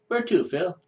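put-on a light Russian-accented taxi driver asking where to. Russian driver greets Phil, mild Russian accent. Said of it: "a Russian stereotype come to life".

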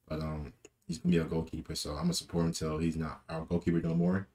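normal talking voice

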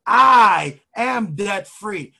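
loud, very slow declaration; rallying affirmation. Shown as a demonstration. Coach has listeners say it along, slow and loud. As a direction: proclaim it, stretching every word. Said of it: "I want you to say it with me".

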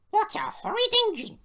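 very high, loud outraged shriek; scandalised screech; screamed. A narrator's reading. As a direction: squeal it in offence. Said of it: "screamed Agnes".

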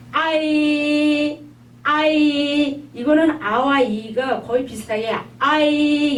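아이 slowly, weight on 아; long stretched 아이 leaning on 아; stressed a in ai. Shown as a demonstration. Teacher showing 아이 slowly with stress on 아. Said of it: "아 쪽에 약간 강세가 있어요".

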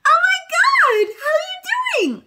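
very high, loud, slow gush; exaggerated excitement. Shown as a demonstration. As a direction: overdo the thrill to grab the bird. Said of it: "I'm exaggerating for you guys".

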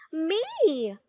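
high-pitched, eager call of me; screaming. A narrator's reading. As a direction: squeal to be chosen. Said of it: "screamed Moody Margaret".

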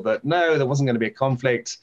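usual speaking voice

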